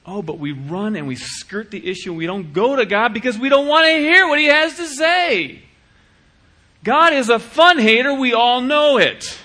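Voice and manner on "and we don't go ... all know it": mock complaint. Mock complaint about God.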